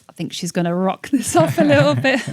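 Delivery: quoting him through laughter; laughing boss quote. Retold or imitated. Boss cheering her on, laughing. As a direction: laugh while doing his line.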